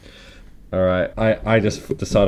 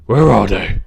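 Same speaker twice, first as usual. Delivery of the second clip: loud, croaky vigilante growl; 5 dB louder than usual. Acted. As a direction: croak out a grave monologue like a masked superhero. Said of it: "a bit basic with Croaky Batman".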